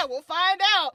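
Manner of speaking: high, loud laughing shrug; laughing tease. Retold or imitated. Host teasing listeners about the final mix, high and laughing. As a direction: toss it off with a big laugh.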